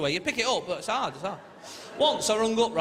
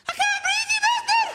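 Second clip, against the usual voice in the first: extremely high, loud squeal; piercing cartoon-squeak outrage; squeaky helium voice; 7 dB louder, slower than usual. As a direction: shriek in panic as if on helium. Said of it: "we got squeaky voices".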